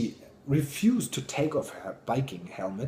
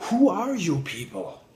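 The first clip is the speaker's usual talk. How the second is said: put-on higher, louder and slow, a bold small girl; little girl demanding; 7 semitones higher, 6 dB louder than usual. The little girl walks into the tent of grown-ups, higher, loud and slow. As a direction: plant your feet and demand it like a tiny war kid.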